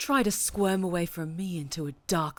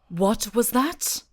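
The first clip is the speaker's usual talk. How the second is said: slow, louder, higher challenge; sharp demand; 4 semitones higher, 8 dB louder, slower than usual. Acted. Captor catching a muttered remark, sharp and louder. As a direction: demand they repeat it, menacing. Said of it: "Nothing, Night Angel".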